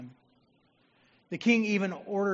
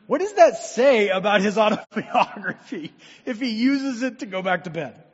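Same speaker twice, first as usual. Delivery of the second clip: laughing; 3 semitones higher, 12 dB louder than usual. Real, not acted. Joking about the boring book.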